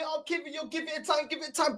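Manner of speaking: soft, mocking echo of the manager's line; half-whispered sneer at the advice; quietly parroting him with scorn; mocking quote. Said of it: "A man trying to tell me".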